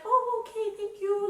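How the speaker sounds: high-pitched imitation of a grateful old person; mock sweet gratitude. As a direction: put on a high, thankful little voice. Acting out lonely old people.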